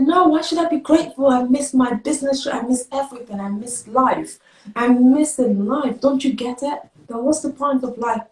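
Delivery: high-pitched, impassioned reenacted protest; anguished outburst. Acted outburst at her doctor.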